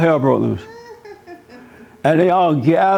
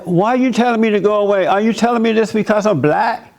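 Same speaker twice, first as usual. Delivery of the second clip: raised, high-pitched indignant challenge; indignant confrontation; 5 semitones higher than usual. Retold or imitated. Confronting teen protesters, high indignant retort. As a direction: demand answers, voice pitched way up. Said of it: "I'm like".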